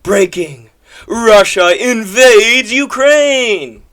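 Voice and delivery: hammed-up breaking-news shout, dragged out; mock a sensational video title, loud and drawn out; clickbait headline. Streamer mocking a rival's clickbait video, hammed-up announcer cry. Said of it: "had to put out this clickbait video".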